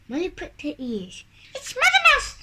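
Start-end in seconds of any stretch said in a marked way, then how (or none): 1.6-2.4 very high, loud squeal; high-pitched mousy shout; squeaking. A narrator's reading. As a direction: squeak it out like an excited little mouse. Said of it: "she squeaked".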